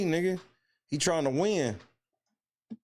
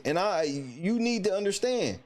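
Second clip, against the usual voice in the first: ordinary talk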